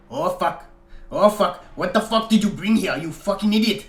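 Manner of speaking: put-on panicked shout in a Russian accent; a lightly voiced Russian accent, alarmed. A narrator's reading. Francis panicking at Nigel, in a Russian accent. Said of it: "heavily accented with what sounded like a Russian accent".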